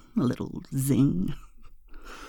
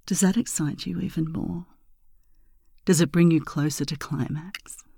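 sultry ASMR. Acted. Intimate ASMR seduction talk.

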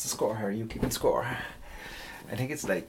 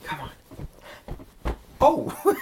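ordinary delivery

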